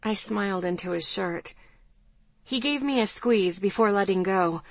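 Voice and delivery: ordinary delivery, nothing marked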